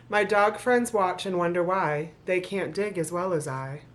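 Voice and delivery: rhythmic storybook reading to an audience; story narration. Acted. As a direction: read the rhymes like a bedtime book. Reads the picture book aloud.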